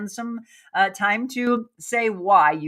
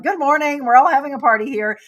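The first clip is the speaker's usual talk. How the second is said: higher, louder, fast party-mood greeting; mock cheery commenters; 6 semitones higher, 6 dB louder than usual. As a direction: play the whole chat chiming in cheerfully. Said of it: "You're all like".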